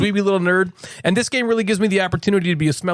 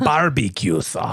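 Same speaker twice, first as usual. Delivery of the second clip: slow, careful Russian trying for American; slow put-on Russian doing American; Russian faking American; 4 semitones lower, much slower than usual. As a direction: drag out barbecue sauce as a Russian faking American. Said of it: "Yeah, still a little".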